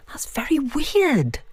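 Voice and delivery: slow, breathy unnerved whisper; hushed, slow and spooked. Acted. Alone, unnerved by stones.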